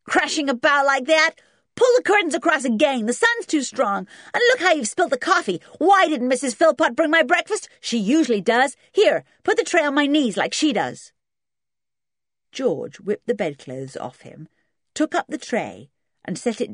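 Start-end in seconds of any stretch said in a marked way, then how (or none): 0.1-11.1 put-on high, loud spoilt-boy tirade; angry. A narrator's reading. Spoilt boy ordering George about in bed, loud and angry. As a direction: rattle off bossy complaints at high pitch. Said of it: "he said angrily".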